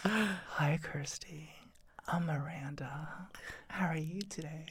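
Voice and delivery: put-on slow whispered girly voice; girl voice whisper. Miranda greeting Kirsty, slow whispered. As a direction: murmur it slow and breathy.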